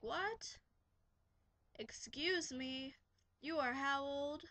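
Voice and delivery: high "What?" then a slow, drawn-out "Excuse me"; incredulous. Retold or imitated. Babysitter stunned by a fifth grader's claim, slow incredulous voice. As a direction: act shocked and slow it right down. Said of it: "And I'm like".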